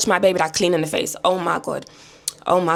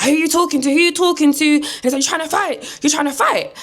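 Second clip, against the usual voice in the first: high-pitched challenge; mock confrontational granddad; 8 semitones higher than usual. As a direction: imitate an old man squaring up, voice pitched up. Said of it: "The granddad's basically been like".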